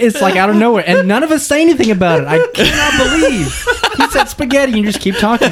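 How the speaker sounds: laughing. Real, not acted. Laughing at the spaghetti clip.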